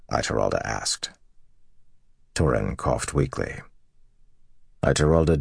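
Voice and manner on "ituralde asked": ordinary delivery, nothing marked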